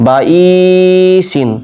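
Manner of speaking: বা ই সিন with short বা and long held ই; quick বা, stretched ই, then সিন; selective elongation. Shown as a demonstration. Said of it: "ই এখানে টান দিচ্ছি". (বা ই সিন-এ শুধু ই টেনে পড়া)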